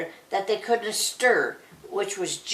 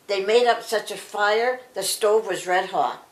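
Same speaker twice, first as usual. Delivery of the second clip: ordinary delivery, nothing marked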